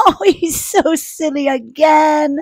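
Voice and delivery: high, giggly amused voice; amused giggle. Acted. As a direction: let a laugh lift the words. Amused by snoring bear.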